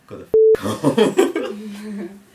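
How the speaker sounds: loud retold cop command, rising high; exasperated order. Cop dismissing the boys, loud exasperated order. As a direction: send them off loudly, imitating the cop.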